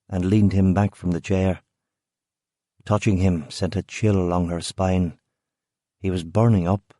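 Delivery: plain reading voice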